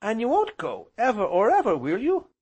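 put-on high, loud, pleading child voice; small boy. A narrator's reading. Small boy begging uncle never to go, high and eager.

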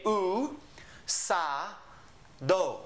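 "U, sa, do" as separate syllables, oo, sah, doh. Shown as a demonstration. Teacher sounding out a Spanish word, "U, sa, do" in separated syllables. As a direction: break "U, sa, do" apart with a pause after each. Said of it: "and we say".

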